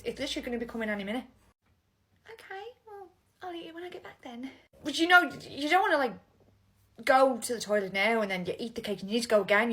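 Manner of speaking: normal talking voice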